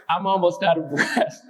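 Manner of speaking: poem line breaking up into real laughter; laughing restart. Real, not acted. Poet restarts the poem amid audience banter, laughing. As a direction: try the opening line and crack up.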